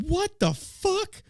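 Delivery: high, slow, stunned "What the fuck?"; shocked while reading a tweet; retold disbelief. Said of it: "I'm like".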